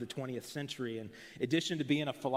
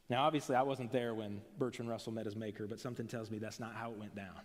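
usual speaking voice

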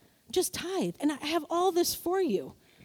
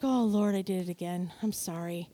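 lower, much softer, fading apology; sheepish prayer; 9 semitones lower, 6 dB quieter than usual. Retold or imitated. Believer apologizing to God after panicking, soft and low. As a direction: confess it under your breath.

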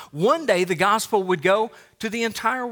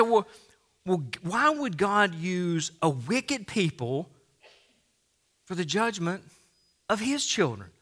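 imagined skeptic's question; 4 dB quieter than usual. Retold or imitated. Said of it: "You say".

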